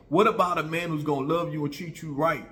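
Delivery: normal talking voice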